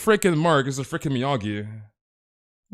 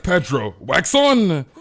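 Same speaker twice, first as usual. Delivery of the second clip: higher, louder mock sensei chant; mock Miyagi coach; 4 semitones higher, 6 dB louder, slower than usual. Mark coaching Pedro through an earpiece, loud mock sensei chant. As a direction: coach him like a hammed-up karate master. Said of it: "And Mark is like".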